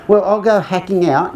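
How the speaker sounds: put-on cockney accent. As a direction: do the English lady with a Cockney twang. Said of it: "This accent gets more and more cockney".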